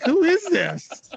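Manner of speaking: mock confused caller. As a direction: play the puzzled guy on the line with a grin. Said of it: "He's like".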